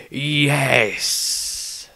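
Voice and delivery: lightly voiced old patriarch, measured; a hint of a grave old judge; grave elder speaking slowly.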